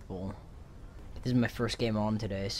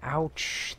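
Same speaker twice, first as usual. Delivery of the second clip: higher, quick pained yelp; sharp ouch; 6 semitones higher than usual. Real, not acted. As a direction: yelp it like you got hit. Hurt in game.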